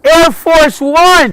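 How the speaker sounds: very high, loud, slow drawn-out yell. Real, not acted. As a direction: bellow it at the sky. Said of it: "Is he gonna keep yelling like that?".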